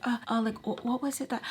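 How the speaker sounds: imitating a soft, falsely casual question; fake nonchalant. Mimicking the woman's probing question, fake nonchalant. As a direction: mimic someone fishing for gossip, softly. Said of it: "trying to be, like, nonchalant".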